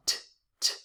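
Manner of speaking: a lone clipped "t"; just the bare "t" sound, short. Shown as a demonstration. Teacher isolating the T sound for students. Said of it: "with the T sound".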